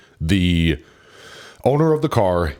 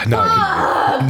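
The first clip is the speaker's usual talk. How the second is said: shout; slower than usual. Real, not acted. Sudden loud start.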